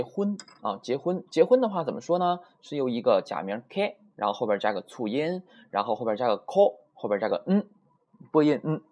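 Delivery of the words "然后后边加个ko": ordinary talk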